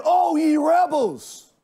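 high, loud, slow furious rebuke; angry yell. Acted. Moses yelling at the complaining people in anger. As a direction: Moses lashing out at the grumbling crowd. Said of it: "and yelled at the people".

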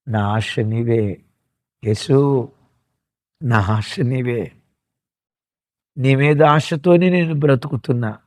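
slow, hushed words of surrender to Jesus; slow soft prayer. Shown as a demonstration. Preacher models a prayer for the congregation, slow and hushed. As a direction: pray it gently, almost under the breath. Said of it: "అనను".

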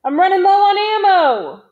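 high, loud alarmed call; yelling. A narrator's reading. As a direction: shout it out across the firing line. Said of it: "the new guy yelled".

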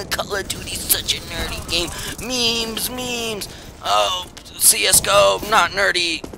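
high-pitched mock meme-fan voice; mocking imitation. Player mimicking what everybody says about the game, mocking. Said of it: "Everybody's like".

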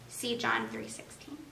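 soft unvoiced whisper, like slipping an answer; whispered hint. Real, not acted. Parent hinting the answer to the kids, whispered. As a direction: whisper the verse as a secret clue.

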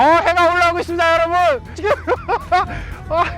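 high, fast thrilled burst; excited. Real, not acted. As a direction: blurt it out in sudden joy. Sun suddenly appears.

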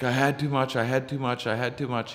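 imitated freak-out. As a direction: mimic a friend panicking, same high loop each time. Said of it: "he starts freaking out".

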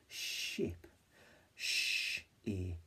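"Ship" then "Sh-ip" stretched, sounds apart; segmented. Shown as a demonstration. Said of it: "so my turn".

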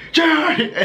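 laughing mock cry of alarm; high-pitched retold yell. Retelling his yell to brother.

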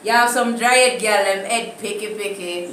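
Jamaican Patois. Shown as a demonstration. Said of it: "So say that in a English".

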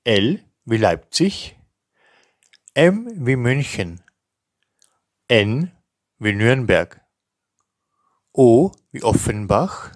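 each letter and name spoken slowly and distinctly with pauses; careful spelling dictation. Shown as a demonstration. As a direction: dictate it clearly, like spelling over the phone. Teacher reads spelling alphabet.

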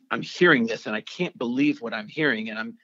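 ordinary delivery

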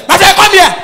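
put-on loud high shout at a child; sharp shout. Auntie shouting at the daydreaming boy, loud and high. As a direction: bark the child over.

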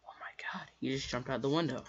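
hushed, breath-only shock; stunned whisper. Retold or imitated. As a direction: whisper it in disbelief. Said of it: "We were all like".

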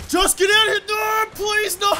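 high, loud, desperate pleading; panicked shout. Real, not acted. Player begging as he gets killed, panicked. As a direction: plead at full voice, breaking into a wail.

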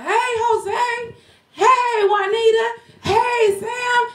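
high, sustained singsong holler; calling out names. Retold or imitated. Daycare owner calling names out of the air, high drawn-out call. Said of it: "I was calling those things".

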